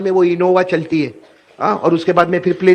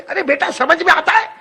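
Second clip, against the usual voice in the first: angry mother; 9 semitones higher than usual. Acted. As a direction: scold him like a furious mother. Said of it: "وہ غصے ہو گئی". (غصے میں ڈانٹتی ماں کی اونچی آواز)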